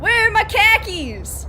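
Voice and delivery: loud, high, drawn-out Boston holler; Boston accent. Shown as a demonstration. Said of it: "kind of do a Boston accent".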